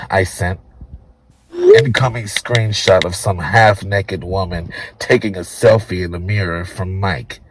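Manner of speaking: ordinary delivery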